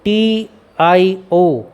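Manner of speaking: T I O drawn out letter by letter; slow spelled letters. Shown as a demonstration. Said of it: "और जब कभी भी".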